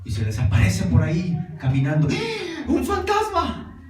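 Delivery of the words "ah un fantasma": mock fright. Disciples see a ghost at night.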